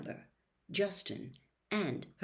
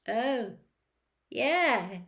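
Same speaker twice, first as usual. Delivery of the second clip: forced enthusiasm; 5 semitones higher, 4 dB louder than usual. A narrator's reading. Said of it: "trying and failing to look pleased".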